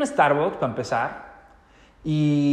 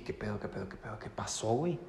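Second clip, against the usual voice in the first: stunned mutter; 5 semitones lower, 14 dB quieter than usual. Retold or imitated. Retold shock inner reaction.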